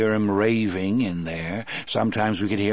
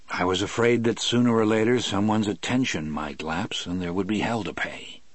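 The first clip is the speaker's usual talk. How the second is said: plain reading voice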